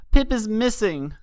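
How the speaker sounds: high frightened scream. A narrator's reading. Colt screams to the Pegasus about his missing friend, high scream. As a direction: scream the news in a panic. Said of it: "he screamed at the Pegasus".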